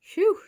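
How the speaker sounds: high, loud exhale of relief; cried "phew". A narrator's reading. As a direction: let out a big phew. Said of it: "she cried".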